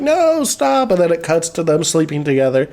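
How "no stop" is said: much higher, coy little protest; mock girlfriend voice. Mocking the girlfriend pushing Dean away, high and coy. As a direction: squeak it like the shy girlfriend. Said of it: "she's like".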